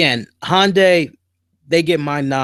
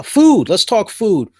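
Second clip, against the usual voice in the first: hype topic announcement; 7 semitones higher, 6 dB louder than usual. Real, not acted.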